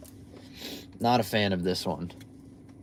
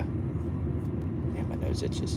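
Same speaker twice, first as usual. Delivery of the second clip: whispering; 9 semitones lower, 9 dB quieter, much slower than usual. Real, not acted. Muttered aside, unvoiced.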